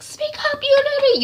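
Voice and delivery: high-pitched acted scolding; enraged mother. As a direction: demand an answer in mock fury. Mother beating, scolding daughter.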